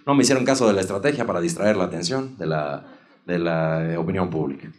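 low, soft excuse-making; hushed, halting low voice; politician impression. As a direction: imitate a statesman explaining away a blunder. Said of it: "Y entonces dice eso".

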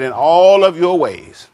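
preacher's emphatic, lengthened "all"; stress on all. Shown as a demonstration. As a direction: stretch and swell the word "all". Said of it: "God is emphasizing. Th-, these, this word".

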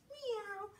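high, soft meow; playful cat meow. Pretend. Teacher playing cat for children, playful high meow. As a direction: mew lightly like a cat.

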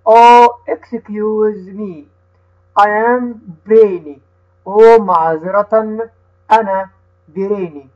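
slow, careful reading with each word set apart for the class to repeat; teacher reads the dialogue slowly so the children can repeat; slow read-aloud for repeating. Shown as a demonstration. Teacher reading slowly to children who repeat after her. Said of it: "هقرأها أنا وهتقول ورايا".

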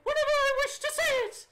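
put-on shrieking, very high old lady with a British accent; loud, outraged British granny voice; old British woman. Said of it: "I can do an old woman British voice".

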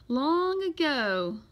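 slow, drawn-out opening; storytelling. A narrator's reading. Librarian starting a tale of a lost ship, slow storytelling. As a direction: stretch it out to open a legend.

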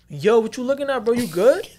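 loud, high-pitched street challenge; mock-confrontational New Yorker. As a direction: call someone out, voice jumping up high. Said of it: "we from New York, so, like, yo".

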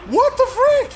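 high furious shout; screaming at the game; outraged shout. Real, not acted. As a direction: yell it at the screen. Gamer outraged by a play.

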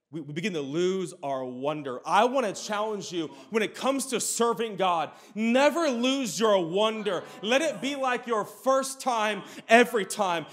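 normal talking voice

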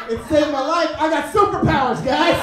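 loud, proud and high; triumphant shout. Real, not acted. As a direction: crow it like a victory. Triumphant punchline.

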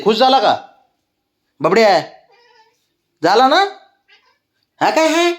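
high, sing-song cooing at the pet. Real, not acted. Owner coos high at her pet inside.

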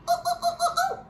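high, quick monkey "ooh ooh" hoots. Acted. Reader hooting like a monkey for the listening child. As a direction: hoot like a playful spider monkey.

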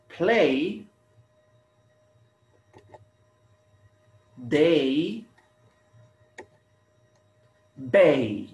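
play, day, bay, one at a time with long pauses; repeat-after-me model. Shown as a demonstration. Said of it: "please repeat after me".

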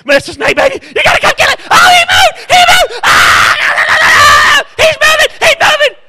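very high frantic screaming; panicked wife. Retold or imitated. Wife in the yard screaming at the moving snake, panicked. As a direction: shriek like the snake just moved. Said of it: "hear Sheriff says".

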